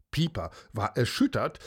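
usual speaking voice

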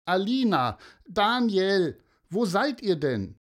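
put-on high-pitched calling for the children; worried calling out. Mother searching for Alina and Daniel on the dike, calling out. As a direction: call their names, pitched well up. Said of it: "immer wieder rief sie".

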